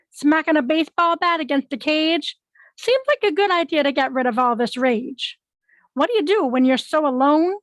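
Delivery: questioning verse with lifted openings; poem recital. Acted. Poet asking on behalf of a friend, expressive poem recital. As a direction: recite it as a run of rhetorical questions.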